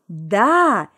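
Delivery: loud, emphatic outburst; shrieked retort. A narrator's reading. Tanka insisting Marusya should have held him, loud shriek. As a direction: snap back at full volume. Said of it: "верещала Танька в трубку".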